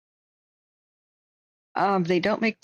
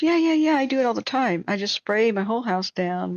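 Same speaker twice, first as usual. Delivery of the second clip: high, louder breezy neighbor voice; imitating the neighbor; 4 semitones higher, 4 dB louder than usual. Aunt's neighbor shrugging off the danger, high and loud imitation. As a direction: play her as carefree and chirpy about it. Neighbor brags about spraying her house.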